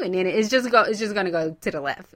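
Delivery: ordinary talk, nothing marked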